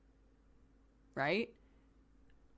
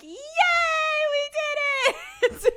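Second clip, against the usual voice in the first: very high, loud, slow cheering; squealing proud-parent cheer through laughter; excited cheer; 11 dB louder, slower than usual. Real, not acted. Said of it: "celebrating toddler's please".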